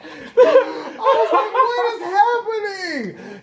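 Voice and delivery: high, slow, laughing disbelief; mock bewildered squeak; stunned laugh. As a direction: stretch it out in shock. Flabbergasted, laughing.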